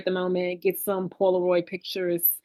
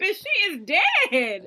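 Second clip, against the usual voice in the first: high, slow, deadpan announcement; blunt Courtney; 9 semitones higher, much slower than usual. Retold or imitated. Courtney telling her friends, slow and blunt. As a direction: drop the news slowly, unbothered. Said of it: "Courtney's like".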